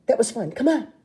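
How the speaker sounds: bright, rising forced cheer; fake bravado. Rue hiding terror, falsely breezy. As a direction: cover fear with breezy confidence. Rue covering her fear.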